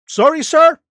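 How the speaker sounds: high, loud mock-polite "sorry, sir"; mock polite sarcasm. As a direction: sneer the politeness. Mock apology to critic.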